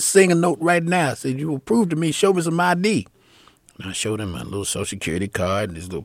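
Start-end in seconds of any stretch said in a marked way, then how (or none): 0.0-1.1 high, loud challenge; imitated angry demand. Suspicious man demands proof. 1.4-3.1 raised, louder insistence; imitated angry demand. Suspicious man demands proof.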